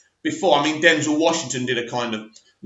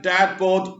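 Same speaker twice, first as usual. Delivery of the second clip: ordinary talk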